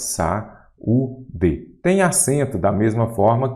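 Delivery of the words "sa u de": "Sa-u-de" split into three separate syllables. Shown as a demonstration. Teacher splitting a word for students, syllable by syllable "Sa-u-de". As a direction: say "Sa-u-de" with a clear break after each syllable. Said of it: "vamos separar em sílabas".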